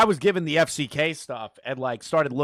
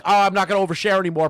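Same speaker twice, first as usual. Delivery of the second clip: quick loud mock of him; fast mocking quote; 5 semitones higher, 7 dB louder, faster than usual. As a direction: rattle off his promise mockingly. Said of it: "he was like".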